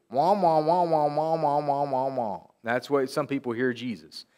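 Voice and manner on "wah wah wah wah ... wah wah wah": muffled trombone-like wah wah babble; Charlie Brown's teacher. Retold or imitated. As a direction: mimic the droning teacher noise, lightly. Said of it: "like Charlie Brown's teacher".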